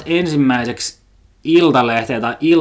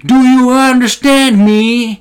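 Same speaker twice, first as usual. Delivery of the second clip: much higher, louder, very slow English demand; mock angry customer; 12 semitones higher, 8 dB louder, much slower than usual. Smoker yelling at foreign cashier, high loud mock. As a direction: bark it slowly at the cashier. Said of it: "alkoi sit jotain heittää".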